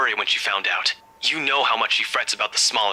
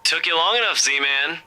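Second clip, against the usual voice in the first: high teasing friend on phone; chirpy and teasing; cheerful buddy voice; 6 semitones higher, slower than usual. Acted.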